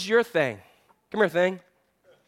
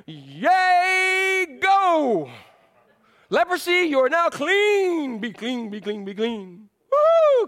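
high, loud hammed-up healer proclaiming a cure; mock revival healer shouting woo; showy faith healer; 9 semitones higher, 4 dB louder, much slower than usual. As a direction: play the flashy miracle man, slow and big on clean. Mocking an expected showy healing.

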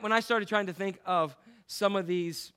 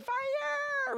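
much higher sudden yell of "Fire!"; mock shout. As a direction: parody the preacher's punchline. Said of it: "I'm thinking".

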